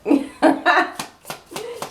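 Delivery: high loud laugh. Real, not acted. Reader cracks up at cheeky card, loud laugh.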